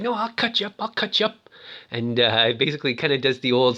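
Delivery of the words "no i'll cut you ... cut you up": put-on defiant kid. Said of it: "He was like".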